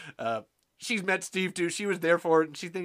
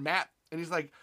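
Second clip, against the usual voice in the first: ordinary talk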